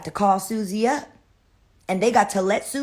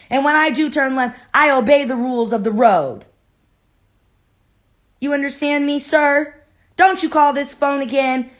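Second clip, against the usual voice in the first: indignant, raised-voice telling-off; mad; 3 semitones higher, 4 dB louder than usual. Acted. Susie scolds the killer who threatened her by phone, mad. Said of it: "So then Susie's mad".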